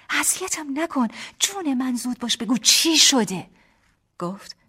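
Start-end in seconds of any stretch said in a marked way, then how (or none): 0.1-3.5 loud, high, insistent plea; impatient pleading. Acted. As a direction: beg urgently to be told. Teased wife begs for the news.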